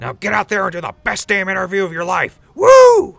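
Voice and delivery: loud, high coach hype; a roaring locker-room send-off; pumped-up pep talk. Acted. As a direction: send him out all fired up. Pep talk before interview.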